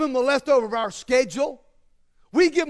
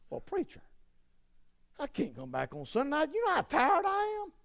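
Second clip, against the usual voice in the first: a mock tired churchgoer, softer, half-whispered; whiny excuse; 3 semitones higher, 8 dB quieter than usual. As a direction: whine it like a worn-out member dodging church. Mock congregant complaining to preacher.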